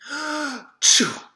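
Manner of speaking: big high sneeze; explosive achoo; loud sneeze. A narrator's reading. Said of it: "Milo sneezed".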